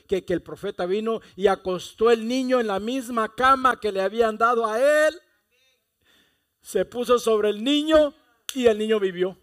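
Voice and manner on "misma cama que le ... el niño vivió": rising fervor. Real, not acted. Climax of resurrection story.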